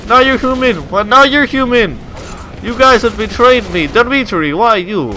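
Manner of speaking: loud, high mock-Russian wailing; hammed-up panicked soldier. Gamer as Russian soldier seeing comrades turn monster, hammed-up panicked shouting. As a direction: cry out to betraying comrades in a put-on accent.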